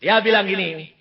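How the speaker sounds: ordinary talk, nothing marked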